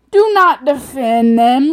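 slow, high, very loud insistence; escalated exasperated demand. Real, not acted. Ranter insisting to an excuse-making friend, loud exasperated demand. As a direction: hammer out each word in exasperation.

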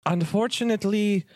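high, bubbly elf voice; cheery high-pitched elf innkeeper welcome; jolly elf Festo. Acted. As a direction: greet them like the jolliest elf alive.

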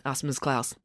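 ordinary delivery, nothing marked

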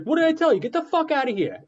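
Mark Wahlberg FBI agent. Retold or imitated. Imagined FBI agent yelling at Boston cops, high laughing mock rant. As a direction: bark at them like an angry Boston fed, cracking up. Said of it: "I just picture Mark Wahlberg".

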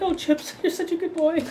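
high, cooing voice; doting shop lady. Acted. Shop lady praising Chips, high and doting. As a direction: coo it high as to a pet.

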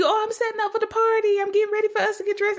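mock excited, rattled off fast; excited rushing. Mimicking herself telling friend about the party, fast and excited. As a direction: gush about party prep at speed. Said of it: "And I'm telling you".

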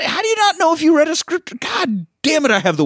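high-pitched comic rant at an agent; exasperated mock outrage. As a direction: start shrieking in disbelief, come down into frustration. Acted actor berates his useless agent.

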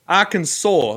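"Arkansas" with a drawn-out saw ending; Arkansas as Arkansaw. Shown as a demonstration. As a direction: stretch "Arkansas" out as AR-kun-SAW. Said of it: "where the W comes in".

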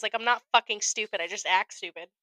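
usual speaking voice

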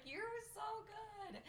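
high, slow, awed whimper. Retold or imitated. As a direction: gush it in mock awe. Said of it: "and I'm like".

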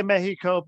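Mexico said Spanish-style, MEH-hee-koh; "Mexico" as Spanish speakers say it, MEH-hee-koh; Spanish pronunciation. Shown as a demonstration. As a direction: say "Mexico" with an h sound for the x. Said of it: "it sounds really insincere".

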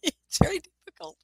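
high, helpless laughing speech; words squeezed out through laughter. Real, not acted. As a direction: talk through real giggles. Laughing at her own story.

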